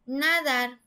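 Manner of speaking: nadar mis-stressed as "nádar", weight on "na"; stress on first syllable. Shown as a demonstration. Teacher testing nadar the wrong way, first syllable stressed. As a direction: punch the "na" in nadar. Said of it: "diría nadar".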